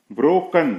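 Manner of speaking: ब्रोकन as "bro-kun", loud and high; correct pronunciation. Shown as a demonstration. Teacher modelling the word, ब्रोकन with reduced last vowel. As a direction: say ब्रोकन with a weak "kun" ending. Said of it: "ब्रोकन पढ़ेंगे".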